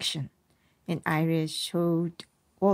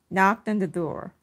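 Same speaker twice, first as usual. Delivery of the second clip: ordinary delivery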